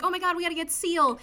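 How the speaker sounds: high, rushed mock alarm; mock panic. Fleeing crowd calling for help, fast mock panic. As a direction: rush it out in pretend panic. Retold panic after wolf attack.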